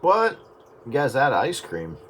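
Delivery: ordinary delivery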